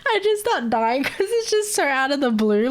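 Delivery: high, giggly telling; laughter still in the voice; laughing while talking. Real, not acted.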